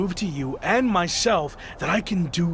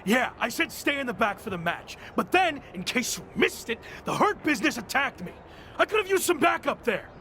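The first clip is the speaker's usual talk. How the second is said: raised, pitched-up angry questions; angry confrontation; 5 semitones higher, 5 dB quieter than usual. Acted. Betrayed champion confronting his teammates, angry and high. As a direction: demand answers, higher and heated.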